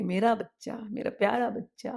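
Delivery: put-on mother cradling her child; tender mother. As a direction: coo to a baby in your arms. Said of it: "माँ उसको गोद में लेकर के".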